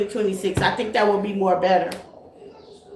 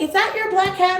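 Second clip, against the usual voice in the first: neighbors asking; 9 semitones higher than usual. Retold or imitated. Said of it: "they like".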